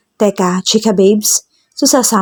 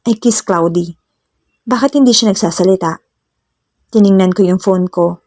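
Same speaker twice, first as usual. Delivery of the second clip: plain reading voice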